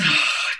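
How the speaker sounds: slow audible exhale; deep breath out. Shown as a demonstration. Breathing out slowly beside an anxious dog. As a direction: show a calming deep breath. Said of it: "take a deep breath with the dog".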